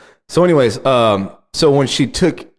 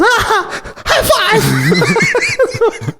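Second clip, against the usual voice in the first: much higher, laughing "high five!"; son's excited shout; much slower than usual. Retold or imitated. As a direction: squeal it like a thrilled little boy. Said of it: "and he goes".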